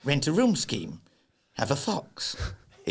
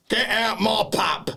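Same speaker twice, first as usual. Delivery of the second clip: hammed-up Cockney landlord's holler; Cockney accent; 8 semitones higher, slower than usual. Shown as a demonstration. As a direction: shout it like a Cockney soap character. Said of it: "Can you do a Cockney accent?".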